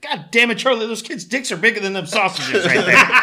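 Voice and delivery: loud, high comic outburst; retold blunt friend. Friend teasing the father at a barbecue, mock outburst. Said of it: "He's like".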